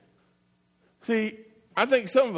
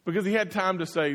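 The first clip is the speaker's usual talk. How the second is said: ordinary delivery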